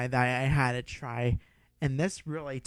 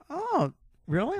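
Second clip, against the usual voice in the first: surprised; 13 semitones higher than usual. Retold or imitated. Quoting own surprised reply.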